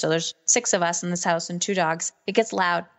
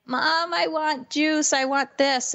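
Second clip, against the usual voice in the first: put-on whining kid; 7 semitones higher, slower than usual.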